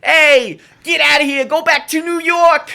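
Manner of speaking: high, loud mocking East Coast yell; hammed-up New York accent jeering the fans; mock New York heckle. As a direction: taunt a Giants fan in a fake New York accent. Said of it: "me and Neil were just like".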